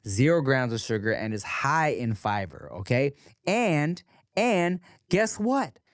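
casual host ad read, unhurried. Acted. Host reading a sponsor ad to listeners, easygoing pitch. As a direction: sell the bread like a friend.

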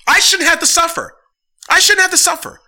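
high, loud, furious rant; fed-up fan shouting at the owners; angry shout. Real, not acted. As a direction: yell it like you're done with them. Ranting at team owners.

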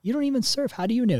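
high-pitched mock indignation; acted high protest; indignant retort. Acted argument with dad.

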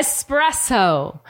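slow, deliberate "Espresso" with an S, no X; correct pronunciation. Shown as a demonstration. Correcting partner who says it with an X, slow correct pronunciation. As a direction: stretch out "Espresso" starting ess-press. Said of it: "There is no X in the word".

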